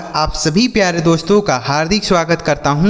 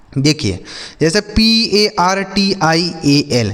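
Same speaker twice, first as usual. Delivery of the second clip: normal talking voice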